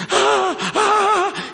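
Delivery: high, loud frightened cries; mock terrified gasps of a cowering demon; imitated cowering gasps. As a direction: act out recoiling in fright. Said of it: "o demônio encolheu o corpo dela na cama assim".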